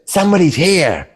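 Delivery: high, sharp kobold cry; kobold calling out in Draconic. Acted. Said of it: "and says".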